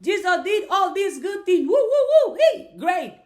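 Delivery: loud, high-pitched gushing with whoops; mock cheering worshipper. Speaker mocking people who only worship Jesus, gushing excitedly. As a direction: cheer like an over-the-top fan. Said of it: "But when you worship Jesus, all you do".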